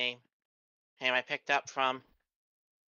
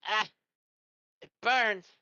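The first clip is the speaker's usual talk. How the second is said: high pained cry, louder; pained yelp; 8 semitones higher, 4 dB louder than usual. Acted. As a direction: cry out as the drink burns. Character reacts to strong whiskey.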